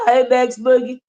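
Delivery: ordinary talk, nothing marked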